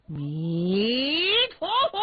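slow Buddhist invocation swelling upward; crisp, ringing voice. A narrator's reading. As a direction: let the chant climb into a loud, clear high ring. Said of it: "这声音是又脆又亮". (又脆又亮、拖长上扬的佛号)

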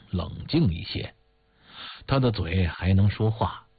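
plain reading voice

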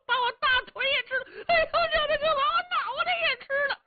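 very high, wailing hammed-up panic, often fast; comic cries for help. Yao Qi inside crying to Jia Fu to save him, high wailing mock panic. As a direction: howl like you are being eaten, overplaying it. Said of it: "姚七在里边嚷上了".

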